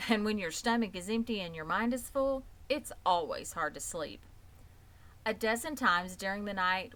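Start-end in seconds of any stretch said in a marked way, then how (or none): none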